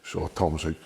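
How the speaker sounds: ordinary talk, nothing marked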